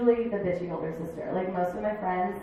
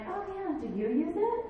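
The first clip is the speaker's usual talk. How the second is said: mock sweet; 10 semitones higher than usual. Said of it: "I could have been like".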